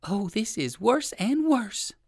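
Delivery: high, slow lament; dismayed rabbit. A narrator's reading. Poor old rabbit trapped by owl, high slow dismay.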